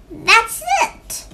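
high, loud burst of excitement; thrilled storybook crow; excited exclamation. A narrator's reading. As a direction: pounce on the idea. Crow seizes on the idea.

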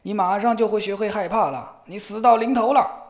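老人高声凶狠地吼叫; 一声比一声响地威吓. A narrator's reading. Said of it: "他吼叫道". (白胡子老人凶狠地大声吼叫)

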